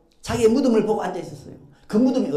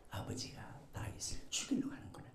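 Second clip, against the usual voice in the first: hushed, much softer inner thought; whispered realization; 10 semitones lower, 17 dB quieter than usual. Acted. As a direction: breathe it as a sinking thought.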